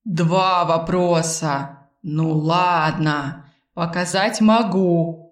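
very slow, drawn-out playful refusal; leisurely, coy drawl; Arvil, teasing companion. Acted. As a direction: take your time and tease the listener.

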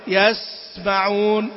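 slow "يسمعون" with س held like two letters; consonant doubled in length. Shown as a demonstration. Teacher showing excess timing, consonant held double. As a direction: linger on the س, then release. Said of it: "يصل إلى وزن حرفين".

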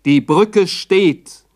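"Die Brücke steht" drawn out very slowly; slow dramatic repeat. A narrator's reading. Narrator stresses that the bridge still stands, very slow. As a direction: let each word land, stunned.

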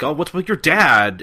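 high, loud mock alarm; startled question. Everybody gawking at Kagura's vomiting dad, startled. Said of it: "and they're like".

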